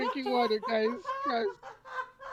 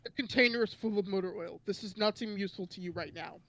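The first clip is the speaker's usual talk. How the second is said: lower, steady reading of game text; reading aloud; 3 semitones lower, 5 dB quieter than usual. Acted. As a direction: read the passage out steadily.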